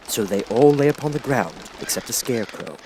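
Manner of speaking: ordinary delivery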